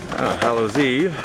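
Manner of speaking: usual speaking voice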